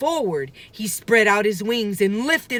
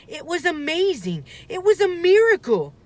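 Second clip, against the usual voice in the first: awe; 11 semitones higher, 4 dB louder than usual. A narrator's reading. Narrating Jack's wonder.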